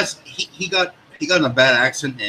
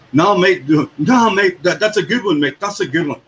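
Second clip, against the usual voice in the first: put-on higher, louder English accent, matey; 7 semitones higher, 4 dB louder than usual. Friend imitating Colt on barbecues, excited English accent. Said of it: "learned an English accent".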